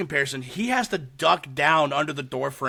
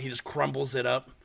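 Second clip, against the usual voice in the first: ordinary talk, nothing marked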